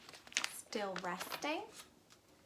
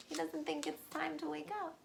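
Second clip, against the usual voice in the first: ordinary delivery, nothing marked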